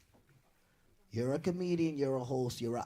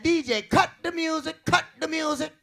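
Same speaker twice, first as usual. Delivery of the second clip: club host shouting; 9 semitones higher, 4 dB louder than usual. Retold or imitated. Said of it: "He said".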